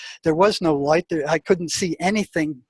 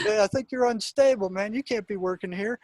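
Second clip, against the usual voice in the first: mock boss brush-off; 6 semitones higher, 4 dB quieter than usual. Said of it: "imagined boss answering mockingly".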